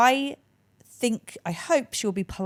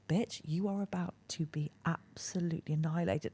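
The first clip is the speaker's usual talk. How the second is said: whisper; 10 dB quieter than usual. Retold or imitated. Narrator's whispered inner aside.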